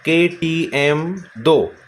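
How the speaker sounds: KTM दो spelled out very slowly; teacher unveiling a mnemonic, slow and deliberate; announced mnemonic. Shown as a demonstration. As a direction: present KTM दो slowly as a memory trick. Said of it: "इस trick का नाम है".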